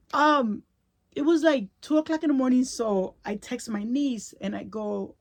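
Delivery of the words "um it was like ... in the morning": crying voice. Real, not acted. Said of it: "I'm crying".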